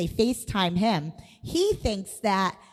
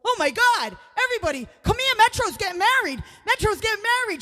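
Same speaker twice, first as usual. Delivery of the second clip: high, loud excited yelling; excited shout; 11 semitones higher, 4 dB louder than usual. Retold or imitated. Brother-in-law shouting to the family about the wedding, excited. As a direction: call the whole family over, thrilled. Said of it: "my brother-in-law goes".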